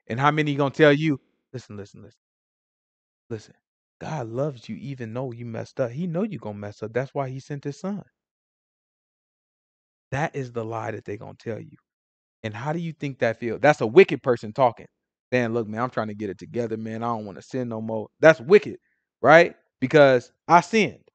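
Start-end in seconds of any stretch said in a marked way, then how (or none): 1.5-8.0 mock reassurance. 15.5-18.1 soft, lowered confiding; acted earnest plea. Sinner trying to get it together, softly pleading.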